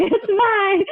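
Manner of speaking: high, strained mock squeak; flustered squeal. Fan answering the laughing bassist, high flustered squeal.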